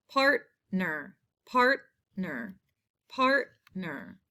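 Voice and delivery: "part... ner", second syllable dropping after a pause. Shown as a demonstration. Teacher sounding out a spelling word, "Partner" split in two. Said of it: "first syllable".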